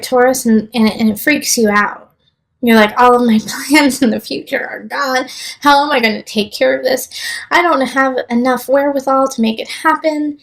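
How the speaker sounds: ordinary delivery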